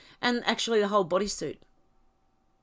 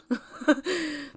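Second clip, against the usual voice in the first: laugh; 8 semitones higher than usual. Real, not acted. Laughs after singing.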